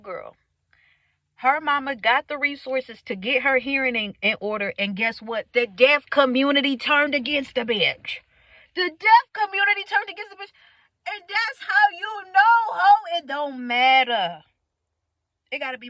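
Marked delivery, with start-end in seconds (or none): 1.4-8.2 loud, emphatic storytelling; loud ranting punch on each phrase; worked-up rant. Real, not acted. Worked-up rant about deaf girl. 8.8-14.4 loud, high-pitched repeat for emphasis; high outraged holler, stretched at the end; outraged rant. Real, not acted.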